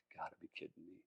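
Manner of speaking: barely audible retold mutter; hushed disbelief. Car owner retelling his reaction to the announcement, very quiet disbelief. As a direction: say it under your breath in disbelief.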